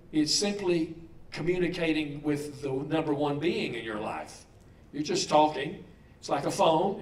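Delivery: usual speaking voice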